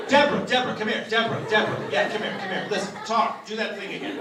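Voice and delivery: loud, high, insistent beckoning; excited calling over. Retold or imitated. Clerk calls colleague over to hear the accent, excited calling. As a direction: imitate a clerk excitedly summoning a workmate.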